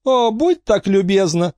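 high-pitched, airy invitation; Angelica's mock courtesy. A narrator's reading. Angelica urging Emma on, high mock courtesy. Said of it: "сказала Анжелика".

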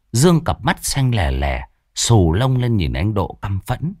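usual speaking voice

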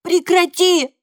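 high, loud yell; boy shouting. A narrator's reading. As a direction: scream at father. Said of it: "кричу я на папу".

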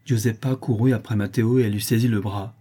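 ordinary delivery

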